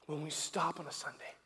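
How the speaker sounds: soft-spoken communion meditation; hushed meditative preaching. Acted. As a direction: draw them quietly into the scene. Hushed communion meditation over music.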